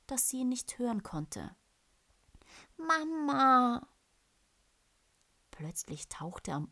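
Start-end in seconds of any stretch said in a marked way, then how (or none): 2.8-3.9 high, loud cry for mama; a lost cub calling his mother. Acted. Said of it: "rief er leise".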